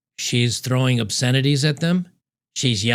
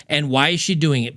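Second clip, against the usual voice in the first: ordinary talk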